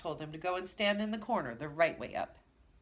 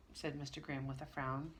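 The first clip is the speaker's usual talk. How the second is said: plain reading voice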